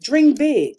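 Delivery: high, loud call to dream big; preached exhortation. Acted. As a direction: proclaim it to the congregation. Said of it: "preached exhortation".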